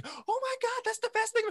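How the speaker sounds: put-on high, amazed child voice; excited kid. Imitating an amazed student, high and gushing. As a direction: gush like a thrilled kid. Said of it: "some of the eyes get big".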